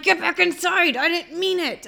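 high, frantic pleading; panicked plea. Retold or imitated. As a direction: beg him back in from the window. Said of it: "I'm like".

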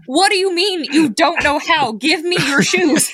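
high-pitched incredulous demand, retold; exasperated demand. Demanding shoes from a confused friend, mock exasperation. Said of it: "I was like".

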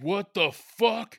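imitating an outraged stepdad, high and loud; loud, drawn-out indignant outburst, retold; mock outrage. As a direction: draw it out slowly in loud disbelief. Retelling stepdad outraged at an insult.

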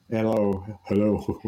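ordinary delivery

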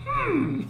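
high, soft pondering hum; high "hmm". Retold or imitated. The book answering Becca, high thoughtful hum. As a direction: hum as if weighing an offer. Voicing the book's wordless reply.

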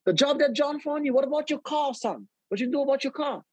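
high, worried what about your car; mother's alarm, pitched well up; alarmed mom. Retold or imitated. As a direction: mom panicking about the car after he quits. Mother shocked he quit.

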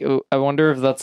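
normal talking voice

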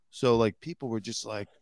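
ordinary talk, nothing marked

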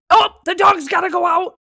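loud, high-pitched mock alarm; mimicking husband. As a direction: call it out like an urgent announcement. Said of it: "he's like".

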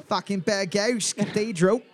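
hammed-up Cockney; mock Cockney accent. Host clowning for quiz players, mock Cockney accent. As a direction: put on a broad Cockney accent. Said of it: "That's Cockney".

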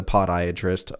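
"podiatrist" as POD-iatrist, dropped casually into speech; "podiatrist" with a full O in the first syllable. Shown as a demonstration. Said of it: "It's like pod, like podcast".

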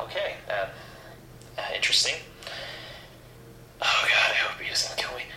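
ordinary talk, nothing marked

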